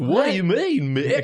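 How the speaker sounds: high dopey Goofy voice. Acted. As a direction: answer innocently as the dopey dog. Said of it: "why is your voice like that".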